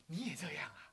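soft conspiratorial whisper; a classmate whispering in surprise; whispered reply. Retold or imitated. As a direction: lean in and whisper back. Friend whispers back in agreement.

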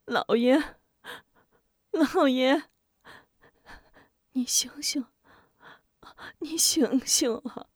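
pleading call. Acted. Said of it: "摇晃呼唤着".

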